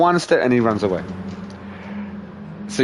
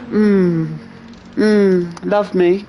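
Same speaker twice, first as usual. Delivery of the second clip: higher, cooing cat voice; needy cat's look; 8 semitones higher, much slower than usual. Retold or imitated. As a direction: imitate a cat asking for love. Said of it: "she gives you that look".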